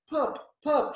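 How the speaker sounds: high calling to the lost toy. Acted. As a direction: call into the space under the shed. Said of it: "she called".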